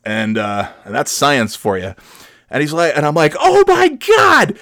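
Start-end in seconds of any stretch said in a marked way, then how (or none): 3.4-4.6 high, slow, stunned oh my God; shocked gasp. Retold or imitated. Driver sees the huge fire, high stunned exclamation. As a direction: react in disbelief. Seeing fire under the car.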